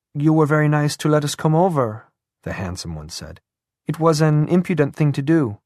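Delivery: put-on bashful, self-reproaching young woman. A narrator's reading.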